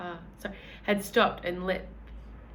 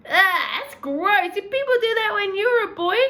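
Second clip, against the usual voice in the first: put-on very high, fast, loud childish disgust; a girl grossed out by chamber pots, high and fast; 11 semitones higher, 7 dB louder than usual. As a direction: squeal in disgust like a young girl. Chloe disgusted.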